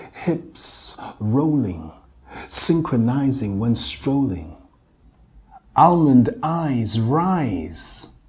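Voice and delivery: loud, slow spoken-word delivery; poem performance. Acted. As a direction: declaim it in short phrases with long pauses.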